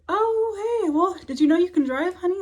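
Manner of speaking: high, loud, sweet sing-song lilt; gushing, musical eye-doctor voice; sing-songy doctor. Retold or imitated. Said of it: "such a sing-songy voice".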